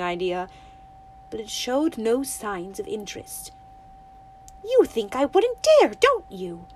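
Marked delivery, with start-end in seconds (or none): 4.6-6.7 put-on high, loud accusing girl. Winnie daring the toad to doubt her, accusing. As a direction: throw the challenge at the toad. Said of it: "she said accusingly".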